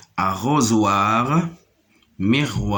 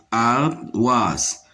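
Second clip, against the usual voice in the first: "ardoise" split in two, ar-doise; syllable by syllable. Shown as a demonstration. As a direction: pause in the middle of "ardoise". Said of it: "se trouve ici dans la deuxième syllabe". (« ardoise » en deux temps : ar… doise)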